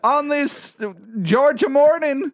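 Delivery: high Southern twang; Georgia accent. Pretend. Co-host wishing an enjoyable drink, in a Georgia accent. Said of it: "is this a Georgian accent?".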